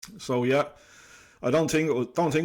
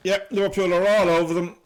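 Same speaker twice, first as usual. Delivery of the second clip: excited; 7 semitones higher, 8 dB louder than usual. Real, not acted. Liverpool pressing.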